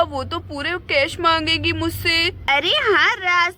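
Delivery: ordinary delivery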